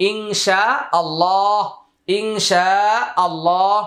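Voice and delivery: ইনশাআল্লাহ slow, ইং nasal-hidden, শা long, আল্লাহ heavy; Quranic ইনশাআল্লাহ. Shown as a demonstration. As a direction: draw out শা and thicken আল্লাহ in ইনশাআল্লাহ. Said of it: "ইংটা একটু ইকফা গুন্না করে নিলেন".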